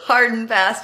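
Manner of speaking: ordinary talk, nothing marked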